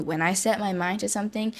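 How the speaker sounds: usual speaking voice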